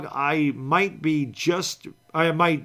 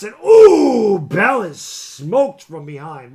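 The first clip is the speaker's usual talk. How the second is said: excited play call; 3 semitones higher, 11 dB louder, much slower than usual. Real, not acted. Live call of a big hit.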